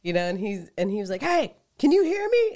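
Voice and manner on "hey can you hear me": high, loud call for attention; imitated yell. Gambler yelling under the plexiglass, imitated yell. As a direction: call out as if through a gap. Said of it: "like, yell underneath a little open space".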